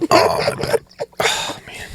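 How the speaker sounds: theatrical sore-back moan; mock aching groan. Podcaster jokes about the tough life of influencers, mock aching groan. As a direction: groan under an imaginary burden, voice strained.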